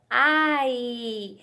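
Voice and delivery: AI stretched into one long glide; drawn-out blend. Shown as a demonstration. Teacher showing children a little word, "ai" drawn out. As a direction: draw out "ai" slowly, joining the vowels. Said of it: "eu formo a palavrinha".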